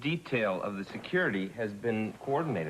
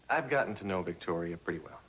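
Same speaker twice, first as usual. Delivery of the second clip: normal talking voice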